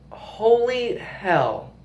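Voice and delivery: slow, drawn-out and high; stretched disbelief. Real, not acted. Vlogger recalls the truck driving off, slow, high, stretched disbelief. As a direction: drag it out in disbelief.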